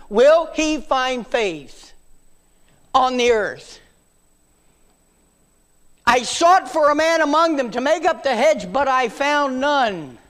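high, loud, slowed scripture line; solemn loud preaching. Acted. Preacher quoting scripture to the church, loud and slow. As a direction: lean on each word with weight.

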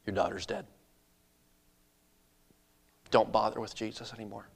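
low, hushed bad news; grave messenger. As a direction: break terrible news softly, with long pauses. Servant breaks devastating news to Jairus.

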